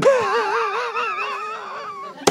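high, far-off devil cackle; the devil laughing at a weak rebuke; devil's mocking cackle. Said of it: "He's like".

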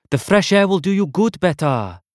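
high, loud doting auntie; breezy, insistent auntie; eccentric aunt. A narrator's reading.

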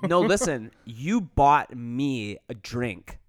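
very slow, "you bought me a drink" spaced word by word; insistent retelling. Retold or imitated. Drunk woman pressing him about the drink, slowly insistent. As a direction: spell out "you bought me a drink" as if to someone not getting it.

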